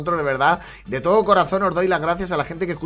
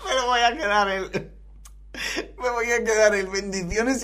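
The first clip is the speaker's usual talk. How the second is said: words squeezed out between laughs; can barely get a word out for laughing; 4 semitones higher than usual. Real, not acted. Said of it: "yo sin poder articular palabra". (hablando entre risas)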